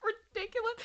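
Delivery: high laughing through words; can barely speak for laughing. Real, not acted. Laughing to her cohost about the parody.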